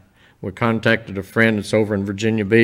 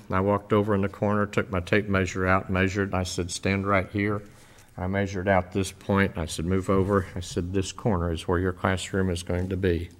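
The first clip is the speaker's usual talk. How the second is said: much softer, holding back tears; emotional, hushed; 2 semitones lower, 7 dB quieter than usual. Real, not acted. Retelling a lady's classroom to the church, moved and hushed. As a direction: tell it gently, moved. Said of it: "I get emotional talking about this".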